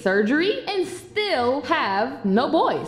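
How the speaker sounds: put-on loud, haughty mean-girl voice; snobby mean friend. Snobby friend brushing off her old friend, loud and haughty. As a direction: look down on her, loudly.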